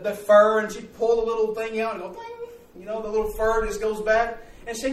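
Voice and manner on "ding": high, soft boing of springing fur; a light, tiny mock ding; springy ding sound. Little sister plays with the lamb's fur, high soft ding.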